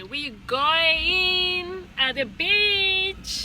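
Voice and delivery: high, loud and very slow sing-song; excited drawn-out announcement. Real, not acted. Mother announcing the beach trip to her kids, high and drawn out. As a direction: stretch it out with excitement.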